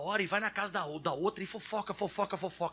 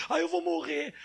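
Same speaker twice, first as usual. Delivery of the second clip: put-on dramatic child; 6 semitones higher, 4 dB louder than usual. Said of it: "ele disse que ia morrer".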